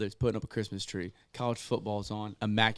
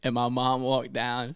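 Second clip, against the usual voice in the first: normal talking voice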